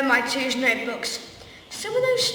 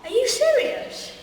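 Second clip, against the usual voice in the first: much higher, disbelieving question; incredulous; 10 semitones higher than usual. Acted. Harry reacts to the villain's magic in disbelief. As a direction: squeak out your shock.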